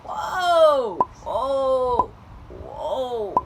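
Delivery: loud, stretched whoa; mock shock. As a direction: back away in alarm. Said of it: "And I'm like".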